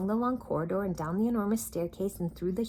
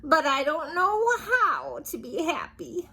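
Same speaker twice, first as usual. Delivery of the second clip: higher, loud sniffly whine; sniffling; 10 semitones higher, 6 dB louder than usual. Acted. King sniffling to Little Miss Sunshine. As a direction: sniff between the words. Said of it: "sniffed the king".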